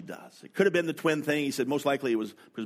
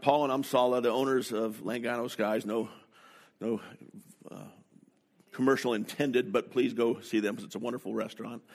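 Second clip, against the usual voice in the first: usual speaking voice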